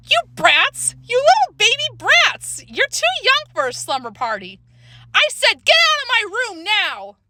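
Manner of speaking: high, indignant holler. A narrator's reading. Older girl scolds the little brats after being hit, hollered. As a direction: holler it high, leaning on the insults. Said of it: "She hollered".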